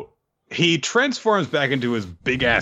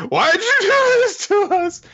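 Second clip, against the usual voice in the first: very high, loud, despairing cry; anguished plea; 4 dB louder, faster than usual. Retold or imitated. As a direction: beg the one who cursed them. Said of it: "they're also like".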